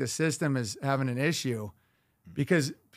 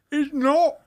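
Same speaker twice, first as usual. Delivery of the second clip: high, loud, very slow mock British accent; 10 semitones higher, 5 dB louder, much slower than usual. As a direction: lampoon a stiff British lady. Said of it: "She has a British accent".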